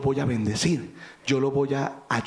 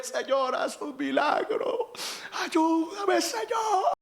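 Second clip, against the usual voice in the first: high, crying plea climbing higher; acted tearful prayer; 12 semitones higher, much slower than usual. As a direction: beg God through mock tears.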